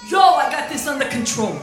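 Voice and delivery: put-on Rocky Balboa accent. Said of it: "I don't know where that accent came from".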